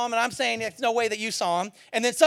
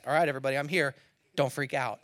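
low, softer, comically calm Jesus; casual reassurance; 8 semitones lower, 6 dB quieter, faster than usual. Retold or imitated. Jesus showing up among his disciples, understated calm. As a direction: settle the room with an offhand low voice. Jesus shows up, calms everybody.

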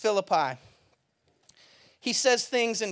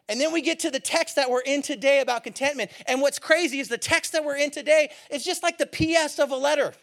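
ordinary talk, nothing marked

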